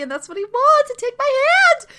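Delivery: acted insistence, rising sharply; mounting urgency. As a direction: pitch climbing high toward the end. Rising urgency of [name]'s acted coaxing.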